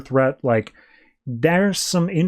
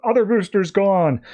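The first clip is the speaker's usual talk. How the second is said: alarmed Michelle; 6 semitones higher than usual. Retold or imitated. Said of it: "he's like".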